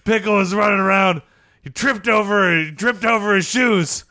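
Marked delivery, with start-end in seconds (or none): none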